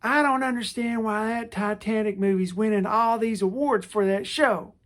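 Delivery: imitating a grumpy radio caller. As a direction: complain like a clueless caller. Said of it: "this person calls in".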